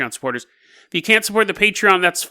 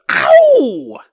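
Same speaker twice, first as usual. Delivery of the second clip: mock ow; 7 semitones higher, 6 dB louder than usual. Victim feels a poke.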